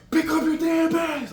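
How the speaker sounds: high, loud barked order, slowed down; drill instructor yell. Retold or imitated. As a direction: scream it like an MTI on arrival day. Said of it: "yelling at you".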